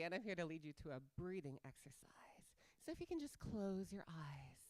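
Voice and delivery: breathy meditation whisper; soft guided whisper. Acted. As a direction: lead the calm in a whisper.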